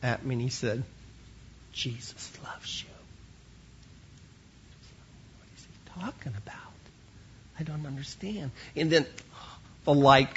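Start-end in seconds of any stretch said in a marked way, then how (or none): 1.7-2.9 hushed, unvoiced-breath secret in the ear; whisper. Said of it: "And in a whisper". 5.4-8.5 hushed, puzzled aside to oneself; bewildered whisper. Retold or imitated. Puzzled inner thought, whispered aside.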